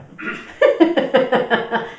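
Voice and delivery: loud, high burst of laughter; real laugh. Real, not acted. Teacher laughing at her own admission, real laugh. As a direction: crack up loudly. Laughs after admitting neither does she.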